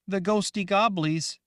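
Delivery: put-on high, loud young girl voice; childlike Rachel. Girl Rachel asking the woman, high and loud. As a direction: sound like a scared little girl.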